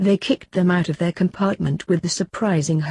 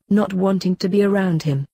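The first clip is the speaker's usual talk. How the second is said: ordinary delivery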